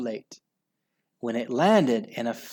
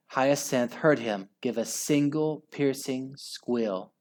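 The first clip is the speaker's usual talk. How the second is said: ordinary delivery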